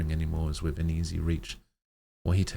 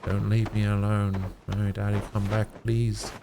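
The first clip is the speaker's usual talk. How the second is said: high, louder begging; desperate plea; 5 semitones higher, 4 dB louder than usual. Acted. Dying girl begs her father to come back, high and pleading. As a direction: cry out for Daddy not to go.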